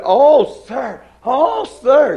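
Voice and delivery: pleading, half in tears, a mock wail; distraught girl.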